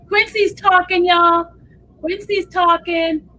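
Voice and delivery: loud, high-pitched excited announcement; excited announcing. Real, not acted. Host tells viewers that Quincy has started talking, excitedly.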